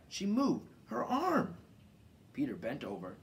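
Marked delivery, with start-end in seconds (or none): 0.1-1.6 loud, high cries, rising further; excited cry. A narrator's reading. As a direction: point it out in a burst. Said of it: "he cried".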